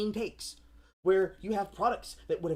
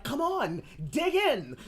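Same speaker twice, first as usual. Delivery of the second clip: pushy host; 7 semitones higher, slower than usual. Retold or imitated. Pushy filmmakers offering food.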